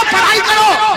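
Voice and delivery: mother scolding loud and high; angry mother. Retold or imitated. Mother repeating command. (गुस्से में डाँटती माँ)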